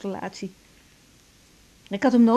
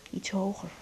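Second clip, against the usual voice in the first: soft whispered request; 3 semitones lower, 9 dB quieter than usual. Acted. Directing lover's massage softly.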